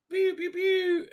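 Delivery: high mock blaster pews, pew pew pew; silly blaster sound effect; blaster pew noises. Imitating blaster fire.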